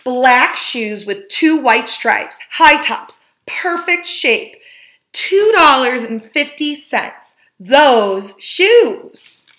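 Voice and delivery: slow, rising wonder at the perfect shoes; excited discovery. A narrator's reading. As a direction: savor each detail like a treasure found. Boy spots dream shoes.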